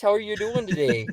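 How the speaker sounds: mock Yooper small talk; Upper Peninsula accent. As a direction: friendly question in a put-on Yooper accent. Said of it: "up in the U P there".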